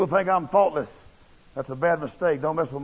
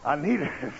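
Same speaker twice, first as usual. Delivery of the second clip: laughing softly; 5 dB quieter than usual. Real, not acted. Admitting need through a laugh.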